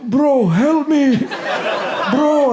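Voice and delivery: high, slow whiny plea; mock dying guy begging "bro"; pleading crash victim. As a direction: beg for help in a strained high voice. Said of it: "And he's like".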